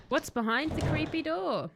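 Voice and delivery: normal talking voice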